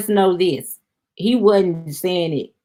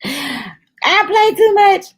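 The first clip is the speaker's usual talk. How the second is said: high playful squeal; 13 semitones higher, 4 dB louder, much slower than usual. Real, not acted.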